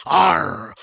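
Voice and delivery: bold pirate growl "Arr"; confident pirate. Shown as a demonstration. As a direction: growl it like a swaggering captain. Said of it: "Maybe you're a confident pirate, like an".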